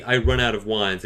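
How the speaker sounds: ordinary delivery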